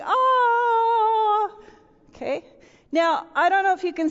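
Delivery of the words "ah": high, loud mock scream. Older brother in the drawing, mock scream. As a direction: yelp like someone going under. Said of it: "He's going".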